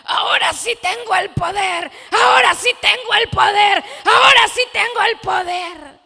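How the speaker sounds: high, full-force shout imitating a newly empowered man; booming, exultant imitation of his cry; triumphant shouting. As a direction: shout it out in triumph, each time bigger. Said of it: "hasta pensé que hasta creció y decía".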